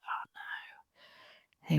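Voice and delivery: hushed dread, barely breathing it; whispered oh no. Retold or imitated. Mum hearing her sick toddler call, whispered dread.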